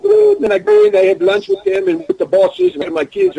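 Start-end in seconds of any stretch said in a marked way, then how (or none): 0.0-0.4 echoing the shout. Retold or imitated. He answers the kids' call.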